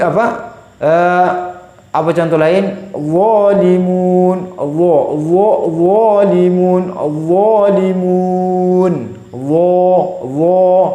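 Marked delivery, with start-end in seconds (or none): 3.0-10.0 zalimun drawn out slowly, heavy and thick; slow heavy recitation. Shown as a demonstration. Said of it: "Sangat tebal ya".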